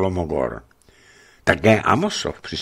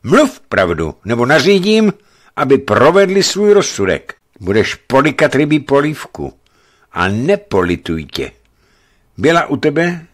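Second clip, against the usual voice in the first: loud, high, threatening command; stern; 9 semitones higher, 6 dB louder than usual. A narrator's reading. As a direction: lay down the law. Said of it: "Rázem zpřísněl".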